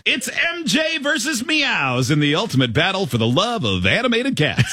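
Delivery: radio host voice. Acted. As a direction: sell it like a morning DJ. Reads AI radio host script.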